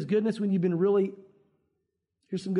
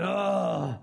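frustrated groan; 3 semitones lower than usual. Retold or imitated.

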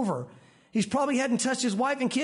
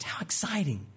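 whispered in wonder, much softer; awed whisper; 4 semitones lower, 5 dB quieter than usual. Real, not acted. Preacher marvels at the leper's healing in a soft whisper. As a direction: breathe it out in hushed awe.

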